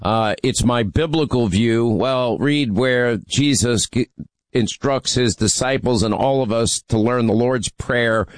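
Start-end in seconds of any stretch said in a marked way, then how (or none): none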